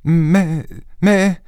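put-on high, startled young woman; taken aback. A narrator's reading. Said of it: "interloquée". (interloquée, d'une voix aiguë)

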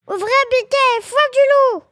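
high, loud mother goat call; crying out. A narrator's reading. Mother goat calling her kids to open up, high cry. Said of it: "crie la chèvre".

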